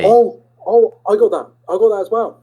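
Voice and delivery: high, loud surprise; surprised. Real, not acted. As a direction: react with sudden surprise. Surprised recognition.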